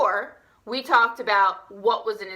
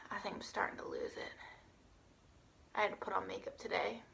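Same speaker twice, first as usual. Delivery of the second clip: hushed, nearly whispered aside; barely-there whisper of playful despair; mock-weary confession; 3 semitones lower, 21 dB quieter, faster than usual. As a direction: confess it very softly.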